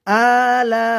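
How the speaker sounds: "আলা" chanted with both vowels stretched; measured elongation. Shown as a demonstration. Teacher showing learners "আলা" with drawn-out vowels. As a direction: hold "আ" one beat, stretch "লা" longer. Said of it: "আ এক আলিফ টান হবে".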